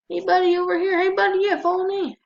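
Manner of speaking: high, loud calling to a mob. Real, not acted. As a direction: lure it over cheerfully. Luring a drowned mob.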